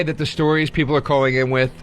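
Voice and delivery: normal talking voice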